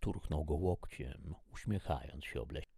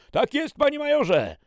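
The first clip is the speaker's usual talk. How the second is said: very high, full-volume military yes-sir; shouted acknowledgement; 16 dB louder than usual. Acted. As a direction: sound off like a drilled recruit. Soldier acknowledges the dressing-down. (wykrzyczane po żołniersku)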